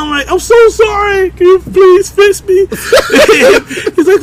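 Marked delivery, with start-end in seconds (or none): 0.3-2.7 very high whimpering apology; mock tearful pleading. Patient begging the angry doctor, mock tearful. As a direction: beg in a hammed-up crying voice.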